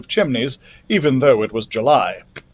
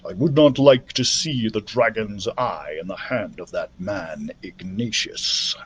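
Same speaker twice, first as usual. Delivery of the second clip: solemn dragon voice; 5 semitones lower, 6 dB quieter than usual. Acted. Dragon speaks, voiced apart.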